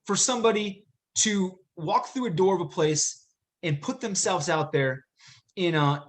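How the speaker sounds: usual speaking voice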